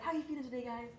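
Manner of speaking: high, bright-sung coach question; cheerful coach. Retold or imitated. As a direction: chirp it like an upbeat coach.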